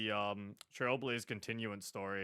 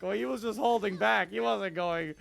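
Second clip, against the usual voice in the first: protesting, louder and higher mock fan voice; indignant fans; 9 semitones higher, 7 dB louder than usual. Streamer quoting upset fans of Yang Qing, mock indignant. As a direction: imitate upset fans making excuses. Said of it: "people were like".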